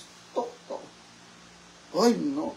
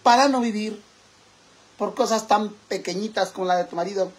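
proud, theatrical manifesto delivery; dramatic recitation; 6 semitones higher, 4 dB louder than usual. Acted. Drag persona reciting a mock poem to her followers, theatrical. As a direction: declaim it like a mock feminist poem.

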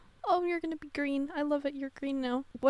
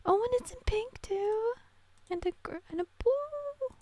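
excited; 11 semitones higher than usual. Real, not acted. Delighted at outfit colours.

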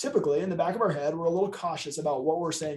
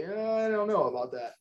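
doubtful client; 5 semitones higher than usual. Retold or imitated. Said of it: "And the client would say".

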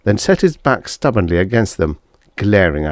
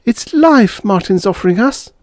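high, louder plea; pleading; 5 dB louder than usual. A narrator's reading. Wife urges husband to accept the offer, pleading. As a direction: urge him desperately, pitched up. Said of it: "pleaded Beth".

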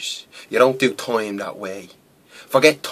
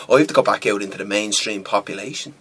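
normal talking voice

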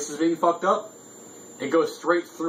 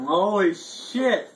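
slow, strained holy shit; strained gasp; 5 semitones higher, much slower than usual. Real, not acted. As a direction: drag it out through pain. Reacting to burning hot sauce.

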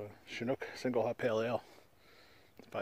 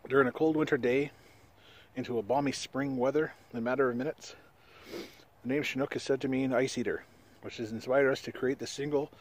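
reading a beer label aloud, a bit louder; 3 semitones higher, 5 dB louder than usual. Acted. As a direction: read it like a product blurb.

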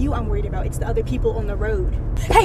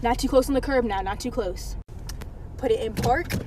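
soft relieved; 8 dB quieter than usual. Acted. Mom calming after parking.

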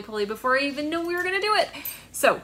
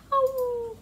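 high, drawn-out howl; wolf howl; 11 semitones higher than usual. Shown as a demonstration. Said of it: "wolves howling".

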